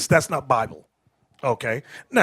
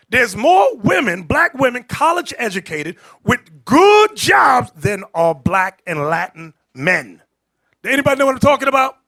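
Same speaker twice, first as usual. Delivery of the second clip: loud, higher preacher's shout; fiery preaching; 4 semitones higher, 6 dB louder than usual. Real, not acted. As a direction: drive the point home to the crowd. Preaching at full force.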